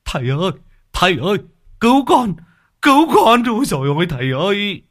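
yelling for his master while fleeing; desperate high wailing for rescue; panicked cry for help. Acted. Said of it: "vừa chạy vừa gào".

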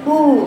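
«أو» خاطئة بشفتين مقلوبتين; اقلب شفتيك في «أو»; شفاه مقلوبة. Shown as a demonstration. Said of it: "بتقلب شفتيها كده". («أو» سطحية كالقرفان)

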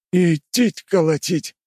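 slow, high, blissful sigh of a line. Acted. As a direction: savour it, eyes closed. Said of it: "сказал он блаженным голосом".

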